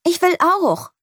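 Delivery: put-on high, eager child's shout; eager call. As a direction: push in eagerly. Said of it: "ruft Lea". (hoch und eifrig gerufen)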